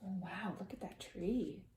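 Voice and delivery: soft aside pointing at the tree; hushed aside. Real, not acted. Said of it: "soft aside about the picture".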